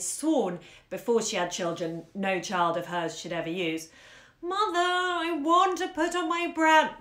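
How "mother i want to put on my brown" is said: high, slow fretting whine; child's nasal whining plea; nasal whimper. Acted. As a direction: whine it like a tired small boy. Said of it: "whimpered in the nasal, fretting tone".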